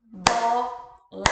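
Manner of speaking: "bola" split into two beats, bo, la; "bola" chanted as two separate syllables. Shown as a demonstration. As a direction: clap out "bola" with a clear break between bo and la. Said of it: "Quantas vezes que eu bati a mão".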